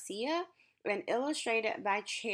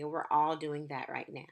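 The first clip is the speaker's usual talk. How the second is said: ordinary talk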